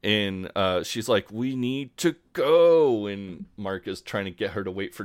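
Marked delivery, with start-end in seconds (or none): 1.3-3.1 higher, slow, drawn-out demand; mock labour urgency. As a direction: imitate a woman in labour pleading to leave. Acting Sophie's urgent demand in labour.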